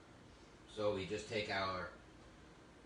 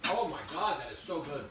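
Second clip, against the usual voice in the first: higher and louder burst of delight; delighted exclamation; 6 semitones higher, 5 dB louder than usual. Real, not acted. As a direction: gasp out your joy at the taste. Tasting the cheese, delighted.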